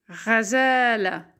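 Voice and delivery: Razala read slowly, syllables joined; slow blended reading. Shown as a demonstration. Teacher reads Razala slowly to the class. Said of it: "ra, ra, za, la".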